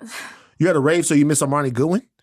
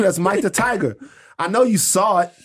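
ordinary delivery

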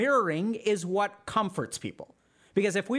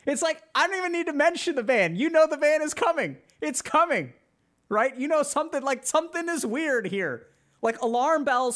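excited; 9 semitones higher, 4 dB louder than usual. Real, not acted. Laughing reaction after creepy demo.

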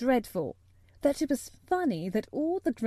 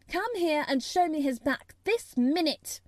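put-on higher-pitched imperious child's order; commanding; 6 semitones higher, 3 dB louder than usual. A narrator's reading. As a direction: order the nurse about. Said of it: "she commanded".

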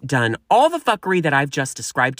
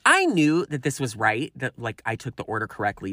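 ordinary delivery

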